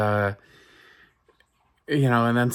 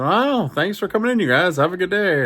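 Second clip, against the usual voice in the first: overly cheery goodbye; 8 semitones higher, 3 dB louder than usual. Retold or imitated. Barber's cheery farewell to customers.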